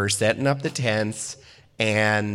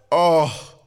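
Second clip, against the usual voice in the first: put-on South Dakota accent; 4 semitones higher, 4 dB louder than usual. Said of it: "she has a very thick accent".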